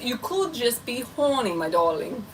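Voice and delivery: quoting Dan in a lightly put-on British accent; a hint of a mock British accent on my darling; teasing viewer in a bad British accent. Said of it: "with my bad British accent".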